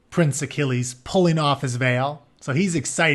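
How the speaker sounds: ordinary delivery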